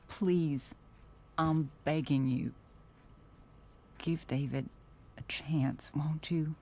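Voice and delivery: high, imploring voice; pleading. Acted. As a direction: beg them earnestly. Mother pleads with daughter.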